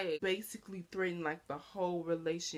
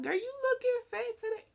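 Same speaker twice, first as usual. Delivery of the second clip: much higher, taunting jab; mock boy teasing; 4 dB louder than usual. Imitating a boy friend insulting her, high and mocking. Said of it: "They be like".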